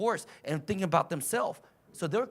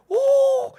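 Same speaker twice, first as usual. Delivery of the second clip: high, loud drawn-out ooh; exaggerated whoop of astonishment; amazed high ooh; 11 dB louder than usual. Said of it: "I was like".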